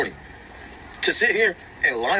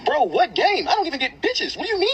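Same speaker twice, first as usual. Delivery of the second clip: incredulous; 11 semitones higher, slower than usual. Real, not acted. Exasperated reaction to wife's text.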